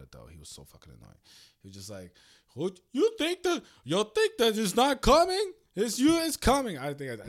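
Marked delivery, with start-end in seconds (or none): 2.5-6.8 loud, high, hammed-up African accent; bad African accent. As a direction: imitate the annoying African coworker badly, loud and high. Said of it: "I did a bad African".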